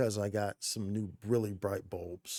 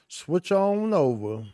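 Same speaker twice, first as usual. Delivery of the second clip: higher, louder, slow singsong; hyped singsong; 8 semitones higher, 6 dB louder, much slower than usual. Real, not acted. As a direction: belt it out playfully. Sung out loud and slow.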